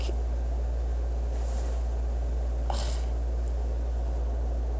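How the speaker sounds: long, quiet sigh; exasperated sigh. Real, not acted. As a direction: let out a long, weary breath. Exasperated rant about being misjudged.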